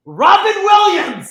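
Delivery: high, drawn-out yell of the name; big hammed-up holler; shouted punchline. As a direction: belt out the name triumphantly, slowly. Shouted punchline of his comeback.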